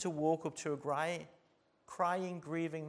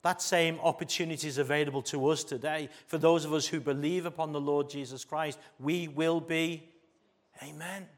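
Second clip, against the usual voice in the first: ordinary talk, nothing marked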